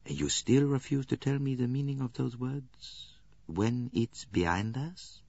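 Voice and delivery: soft, slow and gentle, drawing out the quoted words; a gentle, hushed question to a reluctant witness; very gently. A narrator's reading. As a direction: coax her softly, no pressure. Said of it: "He said very gently".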